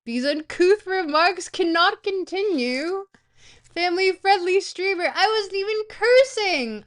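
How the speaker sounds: high, loud prissy scolding voice; hammed-up outrage at chat's language; pearl-clutching mock reprimand; mock prim scold. Mock indignant streamer scolding chat.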